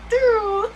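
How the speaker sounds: high, loud "doo" for a car door; sudden high-pitched swooping sound effect; imitated whoosh. Storyteller mimicking the suicide door closing on her friend, high whoosh.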